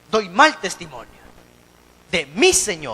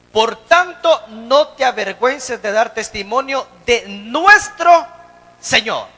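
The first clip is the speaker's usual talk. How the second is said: loud and slow, each phrase proclaimed; forceful scripture reading; 7 dB louder, slower than usual. Real, not acted. Preacher reading scripture aloud to his congregation, forceful and slow. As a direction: proclaim the verse to the church.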